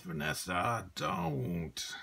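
a child's mock growl. A narrator's reading. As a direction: growl her sister's name at her. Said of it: "she growled".

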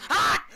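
short, high, loud yelp; sharp scared cry; startled scream. Real, not acted. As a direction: yelp in sudden fright. Startled by Jack.

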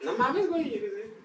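high mock Mexican exclamation; Mexican accent. Challenged to imitate Mexicans, high Mexican accent. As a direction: pitch it up with a Mexican lilt. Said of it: "mexicano, mexicano".

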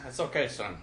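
soft, reassuring sergeant; gentle. Acted. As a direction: suddenly soften and comfort.

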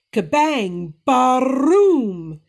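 loud thunder bang; growling thunder. Acted. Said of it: "the thunder growled".

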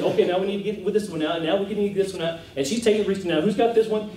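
fast, high-pitched order calling; imitating hurried, organized directing; brisk ordering. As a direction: rattle off orders like running a counter. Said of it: "was barking orders. Not barking, but".

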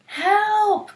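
high loud cry of alarm; cry for help. Acted. Animals calling from the flood, high panicked cry. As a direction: call out in panic. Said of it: "cried Anansi".

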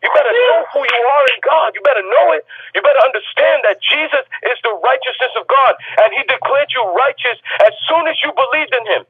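high, shouted sermon climax; preaching at full force. Acted. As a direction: lift the room with each "you better". Pastor at peak preaching.

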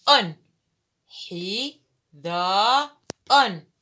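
the word sounded out in separate syllables, ending loud; teacher sounding out a word. Shown as a demonstration. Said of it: "الكلمة الثالثة".

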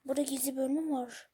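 secret-section whisper. Real, not acted. Gamer whispering about a hidden section. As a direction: hush it like a discovered secret.

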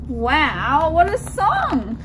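usual speaking voice

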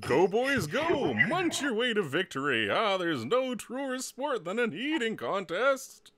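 high, rousing coach's cheer; big, high-pitched sports rallying cry. Acted. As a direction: cheer them on like an overexcited coach. Voicing coach cheering eating contest.

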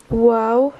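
normal talking voice

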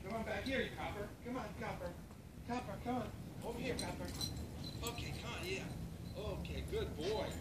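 soft, gentle coaxing to a puppy. Real, not acted. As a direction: softly coax the puppy closer.

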